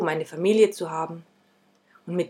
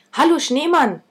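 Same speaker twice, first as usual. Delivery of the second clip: higher, louder call from afar; 9 semitones higher, 4 dB louder than usual. A narrator's reading. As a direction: call across the field. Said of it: "rief ich schon von weitem".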